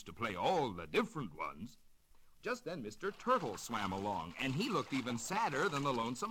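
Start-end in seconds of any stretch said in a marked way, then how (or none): none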